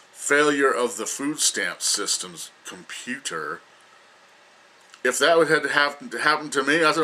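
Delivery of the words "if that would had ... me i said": ordinary talk, nothing marked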